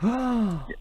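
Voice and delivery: high, soft intake of breath; shocked gasp. Pretend. Radio host hearing caller dismiss Christmas, shocked gasp. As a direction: gasp in mock horror.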